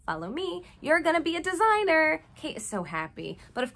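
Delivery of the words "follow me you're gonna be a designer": higher, falsely sweet stepmother; sugary stepmother. Retold or imitated. Elise luring Kate, lightly voiced sugary stepmother. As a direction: lure her in sweetly. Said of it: "Elise tells Kate".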